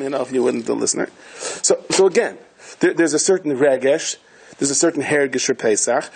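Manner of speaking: ordinary talk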